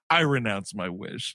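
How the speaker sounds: low, grave mock vow; lightly voiced low vow; mock solemn. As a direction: say it deep and deadpan. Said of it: "and he's like".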